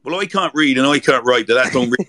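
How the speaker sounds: a loud, hammed-up Swindon yokel rhyme; Swindon accent. Shown as a demonstration. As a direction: belt out the rhyme in broad Swindon. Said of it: "give us a Swindonian sentence".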